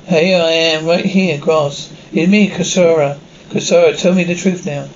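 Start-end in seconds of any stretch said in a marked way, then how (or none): none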